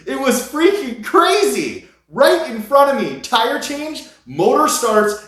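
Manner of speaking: reliving the rush, bursting out; real excitement. Real, not acted. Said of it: "I have goosebumps telling this".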